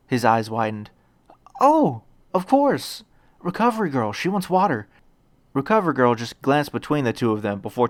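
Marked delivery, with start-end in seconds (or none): 1.6-4.9 higher, earnest schoolboy voice; a nervous, kind boy hero; lightly raised young hero voice; eager young boy Deku. A narrator's reading.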